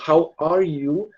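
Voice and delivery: very slow "How are you", each word apart; slow corrected phrase. Shown as a demonstration. Teacher slowly showing students the corrected question. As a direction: draw it out to show the auxiliary. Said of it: "tinha que ter esse are antes do you".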